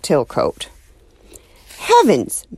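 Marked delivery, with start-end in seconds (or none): 1.8-2.4 high, loud shocked cry; startled exclamation. Acted. Neighbor shocked at a penguin, exclaiming high. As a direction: gasp it out in alarm. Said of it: "she exclaimed".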